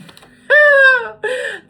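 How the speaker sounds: big burst of high laughter; real burst of laughter; loud laugh. Real, not acted. Laughs about melting skin.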